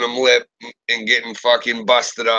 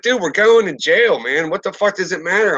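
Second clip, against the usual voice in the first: retold yell; 6 semitones higher than usual. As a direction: shout back at your buddy like nothing matters. Yelling at his friend in the cruiser.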